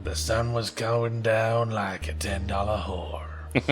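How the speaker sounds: noir narrator. Pretend. Noir voiceover parody.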